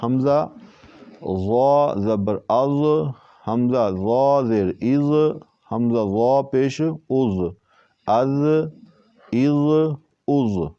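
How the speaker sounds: "از" thick and full; heavy zoe. Shown as a demonstration. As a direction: drill "از" heavy and rounded. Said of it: "اس کو تھوڑا موٹا کر کے".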